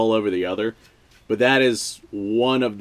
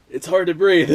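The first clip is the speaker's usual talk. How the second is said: breathless laughing disgust; 9 semitones higher, 8 dB louder than usual. Real, not acted. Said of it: "My eyes are watering".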